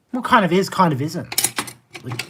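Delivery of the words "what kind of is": ordinary delivery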